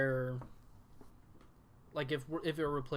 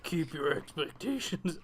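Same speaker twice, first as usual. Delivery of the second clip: Yoda voice; 3 semitones higher, 3 dB louder, slower than usual. Acted. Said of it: "Sound like Yoda".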